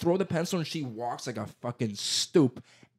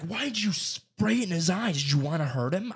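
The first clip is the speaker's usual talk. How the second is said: accusing adult; 7 semitones higher than usual. Retold or imitated. Parents accusing, retold.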